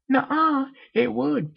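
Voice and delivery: very high, bubbly cartoon pony; high and loud, childlike insistence; Pinkie Pie voice. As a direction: squeak it out like a hyper party pony.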